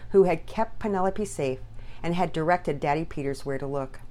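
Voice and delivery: ordinary delivery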